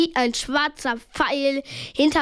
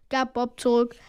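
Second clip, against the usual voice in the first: ordinary delivery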